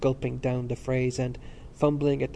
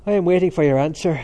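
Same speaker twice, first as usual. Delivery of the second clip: loud, higher demand; brief; 5 semitones higher, 9 dB louder than usual. A narrator's reading. McCann pressing Stephen for his answer, brief and loud. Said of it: "said McCann briefly".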